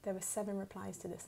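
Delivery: ordinary talk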